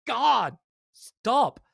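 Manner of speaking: fed up. Retold or imitated.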